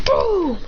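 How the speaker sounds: high, falling boom noise; imitated explosion. Fire arrow goes off, high imitated boom. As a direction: sound out the blast.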